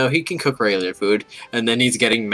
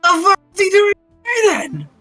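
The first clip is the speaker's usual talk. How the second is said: shrill-voiced angry squeal; high squeaky character outburst; 5 dB louder, faster than usual. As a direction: squeak it out indignantly.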